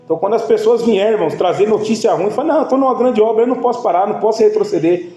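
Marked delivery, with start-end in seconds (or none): 2.5-5.1 higher, driving pulpit delivery; preaching emphatically. Acted. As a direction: hammer the line home to the congregation. Preacher repeats the answer, escalating. (pregando com força, a voz subindo)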